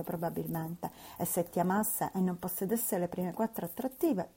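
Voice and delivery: ordinary delivery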